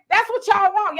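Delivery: ordinary talk, nothing marked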